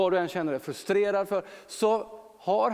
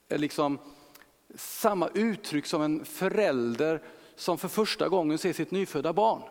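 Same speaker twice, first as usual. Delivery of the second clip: usual speaking voice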